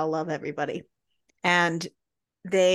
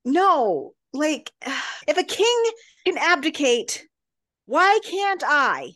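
higher, louder exasperated protest; pitched-up indignant appeal; exasperated plea; 8 semitones higher, 4 dB louder than usual. Retold or imitated. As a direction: plead it like it's obvious, voice up high. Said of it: "He's like".

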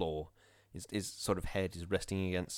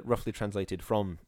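usual speaking voice

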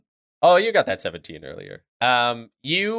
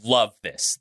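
ordinary delivery

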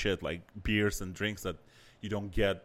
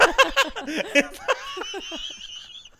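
rising squeal of a laugh; a long high giggle; 5 dB louder than usual. Real, not acted. Laughing at bomb joke.

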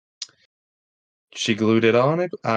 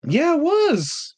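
mock-high yes; much slower than usual. Exaggerated high slow agreement.

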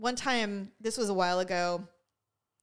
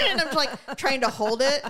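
laughing through the words; high, loud laughter while talking; 5 semitones higher, 4 dB louder than usual. Real, not acted. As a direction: laughs at herself for nearly crying. Laughing while telling of tears.

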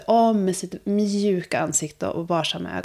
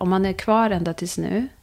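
usual speaking voice

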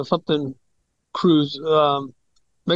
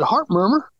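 questioning echo, pitched up; puzzled query; 4 semitones higher than usual. Retold or imitated. Patient questions his doctor's news, puzzled. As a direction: repeat it back as a surprised question.

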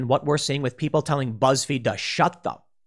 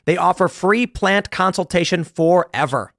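upbeat sponsor read, slightly louder; 3 dB louder than usual. Acted. Host reading a sponsor ad to listeners, upbeat pitch. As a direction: sell the deal to listeners.

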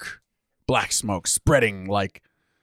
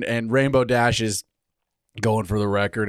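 ordinary talk, nothing marked

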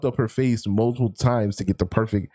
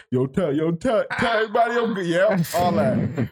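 mock dying gangster; 8 semitones higher than usual. Mocking a shot guy dying.

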